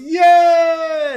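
loud high mock cheer; imitating cheering workers; crowd cheer. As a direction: shout like a happy crowd. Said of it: "everybody goes".

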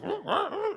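high-pitched imitated creature babble; manic creature noises. Imitating Yoda rummaging through camp, manic squawks. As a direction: squeal like a little critter on a rampage. Creature runs around throwing things.

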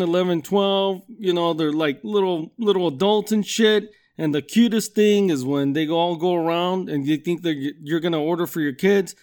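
ordinary talk

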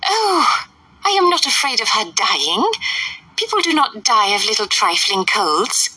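high, fluttery mother's voice; fussy Mrs Bennet. A narrator's reading. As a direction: play the indignant silly mother.

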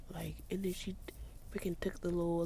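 hushed gossip. Real, not acted. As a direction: talk under the breath so she won't hear. Narrating scene outside quietly.

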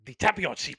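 put-on loud, emphatic parody African accent; pompous wrestler in a put-on Nigerian accent; mock Nigerian accent. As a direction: declare it in a hammed-up Nigerian accent. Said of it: "We want you to do a Nigerian accent".

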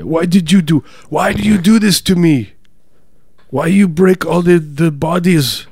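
high, loud lament in a bad German accent; mock German accent. Accused man asking why the bodies were broken, bad German accent. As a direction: wail it in a muddled fake German accent. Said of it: "No, I'm German".

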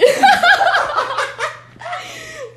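bursting into loud high laughter. Real, not acted. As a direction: cackle out loud at yourself. Friend's joke cracks her up.

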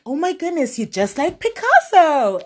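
high, loud gushing praise; high, thrilled mock praise; gushing compliment. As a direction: gush like it's the best compliment ever. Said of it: "it's as good as saying".

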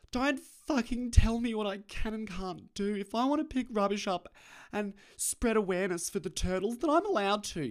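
high, loud mock-outrage; imitated indignant objectors. Imagined people objecting to the speaker's criticism, indignant mock voice. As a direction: protest back in a high, whiny-defensive put-on voice. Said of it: "I can already hear, hear people now".

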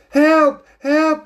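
high, loud shrieked cry; shouting for help. A narrator's reading. Lady cat with cake-covered face shouts shrilly. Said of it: "the lady shouted".

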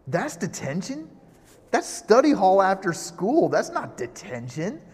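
hammed-up teenage incredulity; mock disbelief. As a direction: play up indignant disbelief at your friends. Acts out his disbelief to friends.

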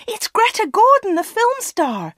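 high, starstruck shouting; excited shout. A narrator's reading. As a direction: shout the big news upstairs. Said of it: "shouted Anna".

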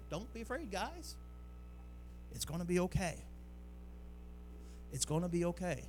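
Jesus calming the disciples, softly reassuring; calm reassurance. Retold or imitated. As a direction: soothe frightened friends in a storm. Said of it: "I don't think he said it in a scared, desperate voice".

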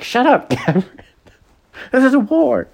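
high, loud joking outburst; playful shriek at Gavin; mock shout. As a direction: blurt it out, laughing and exasperated. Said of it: "She's like".

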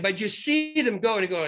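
usual speaking voice

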